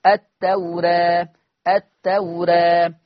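التوراة slowed, alif leaning toward e; taqlil demo. Shown as a demonstration. Teacher repeats التوراة slowly to show the reading. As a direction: draw out التوراة with a tilted alif. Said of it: "الألف مقللة قولا واحدا".

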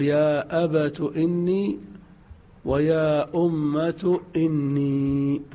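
"أبت" and "أمة" ending in u, slow; أبت with damma. Shown as a demonstration. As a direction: round the final ta into u. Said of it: "تاء مضمومة". ("أبتُ" بتاء مضمومة)

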